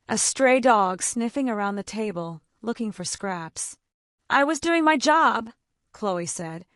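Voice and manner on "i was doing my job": a touch of tremble, held up and louder; shaky but defiant. A narrator's reading. Chloe defends herself to the senator, shaky but proud. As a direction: stand your ground though shaken. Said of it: "her voice shaking but her chin held high".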